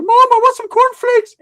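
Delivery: put-on high, loud, slow kid voice; childish mock plea. [name] pretending to be a child for the aunt, high whiny plea. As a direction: whine it like a little boy asking mom. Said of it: "[name] went over there".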